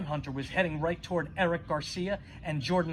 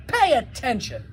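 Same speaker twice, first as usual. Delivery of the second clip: sharp, louder scold; 7 semitones higher, 5 dB louder than usual. Acted. Merlin scolding a distracted texting boy, loud and sharp. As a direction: snap him out of it. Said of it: "slapped Eric in the back of the head and said".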